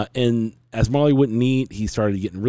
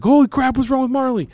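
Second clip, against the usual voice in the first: much higher, loud startled outburst; alarmed son; 4 dB louder than usual. Retold or imitated. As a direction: imitate the son waking to a scary sight. Son alarmed, seeing seizing dog.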